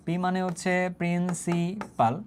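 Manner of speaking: very slow, 'principal' broken into syllables; slow dictation. Shown as a demonstration. Teacher repeats what P stands for, dictating slowly. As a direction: draw out 'principal' piece by piece for note-takers.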